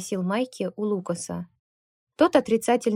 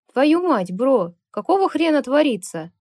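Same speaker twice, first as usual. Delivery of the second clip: loud booming oath, higher; 5 semitones higher, 6 dB louder than usual. A narrator's reading. Lucas's friend booms out as he runs up. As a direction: boom it out in alarm. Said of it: "пробасил голос приятеля".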